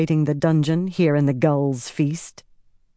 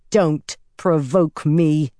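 growled threat; much slower than usual. Acted. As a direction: growl it out slowly as a warning. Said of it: "she growled".

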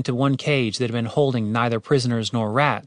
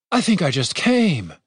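blissful daze; 5 semitones higher than usual. A narrator's reading. Darren dazed after healing.

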